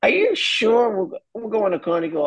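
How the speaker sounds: high incredulous father voice; doubtful father. Retold or imitated. Father asking his son in disbelief, high incredulous voice. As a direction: play the amazed dad double-checking. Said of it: "He goes".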